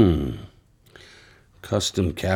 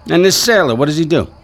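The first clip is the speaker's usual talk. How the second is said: loud, higher demanding question; pressing hard; 6 semitones higher, 5 dB louder, faster than usual. Acted. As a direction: push the witness for more. Sergeant pressing the witness.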